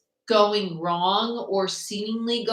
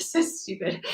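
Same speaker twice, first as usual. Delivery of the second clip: said through real giggles; laughing; 13 semitones higher, slower than usual. Real, not acted. Tarot reader cracks up at her own silliness, laughing. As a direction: crack up while you say it.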